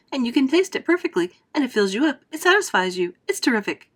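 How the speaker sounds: rapid enthusing. Acted. Wonka to Violet, gushing fast about his gum. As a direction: rattle off the wonders excitedly.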